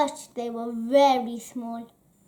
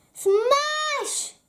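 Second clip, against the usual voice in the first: smash; 12 semitones higher than usual. Acted. Grapefruit smashed.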